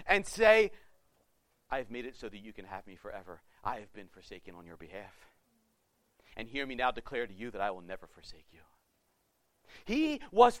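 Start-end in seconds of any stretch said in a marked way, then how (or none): 1.7-8.6 gentle Jesus. Pretend. Jesus speaks to the sinners.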